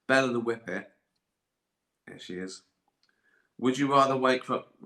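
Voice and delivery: normal talking voice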